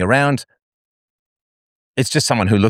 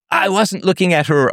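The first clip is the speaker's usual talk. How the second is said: defensive husband; 11 semitones higher than usual. Retold or imitated.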